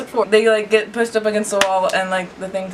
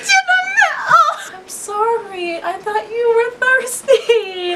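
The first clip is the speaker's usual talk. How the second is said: mock panicked shout; 13 semitones higher, much slower than usual. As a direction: cry out in hammed-up horror. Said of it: "I was like".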